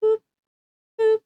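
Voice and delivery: light mock boop noise; playful boop sound. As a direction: pop out a cartoon boop. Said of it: "so upset that they just start".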